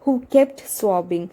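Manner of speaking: reads the English text slowly, word by word; slow text reading. Acted. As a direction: spell it out slowly for the students. (പതുക്കെ വായിക്കുന്ന പാഠം)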